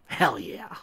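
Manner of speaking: quick rising hell yeah; cheer. Real, not acted. Reader celebrating the shots, quick cheer. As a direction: celebrate the kill.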